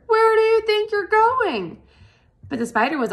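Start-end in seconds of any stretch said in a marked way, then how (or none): 0.1-1.8 high, loud desperate cry; Wilbur screaming. A narrator's reading. Wilbur screams after the flying spider, high and loud. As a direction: yell after the departing spider. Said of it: "screamed Wilbur".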